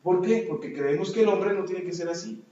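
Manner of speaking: ordinary delivery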